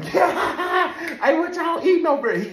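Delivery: high, loud fake-friendly guard voice, laughing; guard strolling up pretending to chat; nosy guard. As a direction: play the nosy officer all innocent. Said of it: "Make him".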